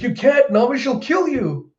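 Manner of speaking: high-pitched pleading character voice; panicked plea. Retold or imitated. Zeus pleads while fleeing.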